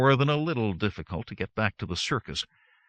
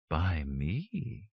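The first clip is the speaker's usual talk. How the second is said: soft, low seductive whisper; 5 semitones lower, 6 dB quieter than usual. A narrator's reading. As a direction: breathe it like a sex bot. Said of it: "a sex bot whispered seductively".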